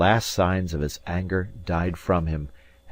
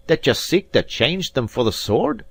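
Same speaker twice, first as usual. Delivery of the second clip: higher, louder mocking challenge; sneering Scots rival, raised; taunting Robin; 8 semitones higher, 4 dB louder than usual. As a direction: goad him toward the sword.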